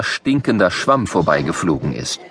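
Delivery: usual speaking voice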